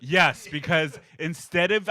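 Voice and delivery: ordinary talk, nothing marked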